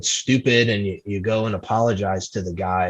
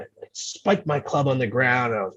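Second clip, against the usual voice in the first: ordinary delivery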